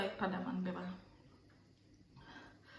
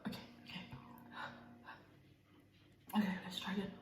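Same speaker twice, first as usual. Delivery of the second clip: whispering; 11 dB quieter, much faster than usual. Real, not acted. Psyching up for next bite.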